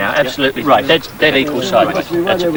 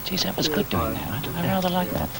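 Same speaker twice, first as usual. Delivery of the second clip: mock musing aside; 2 semitones higher, 13 dB quieter than usual. Mock quote of colleague.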